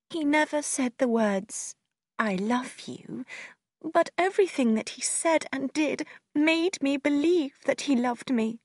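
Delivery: high, plaintive explanation; heartbroken sister. A narrator's reading. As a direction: defend her belief that he loved her.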